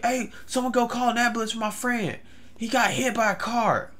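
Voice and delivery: high-pitched mock call for help; imitated shouting. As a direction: shout for an ambulance, held back in volume. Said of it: "notice this guy is shouting".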